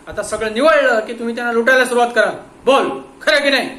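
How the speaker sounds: loud, high accusing voice; menacing accusation. Acted. Inspector accusing the watchman to break him, loud and menacing. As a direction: lay out the charge and threaten.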